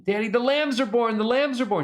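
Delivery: put-on high, loud, thrilled child's call; excited little girl. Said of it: "She's so excited".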